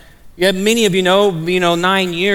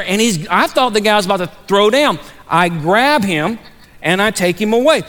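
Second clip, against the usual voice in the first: normal talking voice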